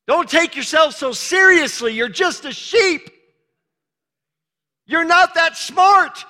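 loud high preaching shout. Real, not acted. Preacher to congregation, loud teasing shout. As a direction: shout it out, half teasing the flock.

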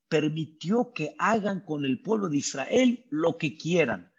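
normal talking voice

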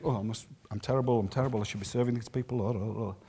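low, soft, mumbled wallowing; mock self-pity. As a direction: grumble weakly to yourself in self-pity. Acts own self-pitying thoughts.

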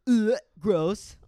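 loud high mock disgust. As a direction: recoil at the feet. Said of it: "he was still like".

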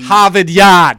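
shouted Harvard Yard in a hammed-up Boston accent. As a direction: belt it out in a mock Boston accent. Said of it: "I was hoping you were gonna do a Boston accent".

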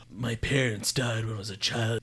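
lightly voiced mock-grave hero; imitating brooding Batman. Podcaster summing up Batman's gravitas, lightly mimicked. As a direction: put on a touch of superhero gravitas. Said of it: "The Batman".

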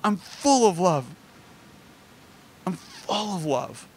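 a hint of grandpa's heartfelt insistence; grandpa repeating himself. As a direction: repeat it lingeringly, long pause between. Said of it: "repeating it again and again".

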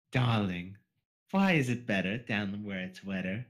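a hint of posh pony Rarity; mild Rarity-style reading; Rarity accent. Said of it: "supposed to be a Jamaican accent, not Rarity accent".

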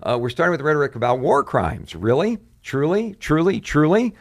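exasperated mock outrage building through repeated truly; imitating angry retort. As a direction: quote the president's angry pushback with rising disbelief. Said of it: "he started getting visibly angry".

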